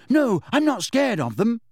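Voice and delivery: high, indignant schoolboy denial; upset boy protesting. A narrator's reading. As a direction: insist anxiously that it's untrue. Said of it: "looking upset".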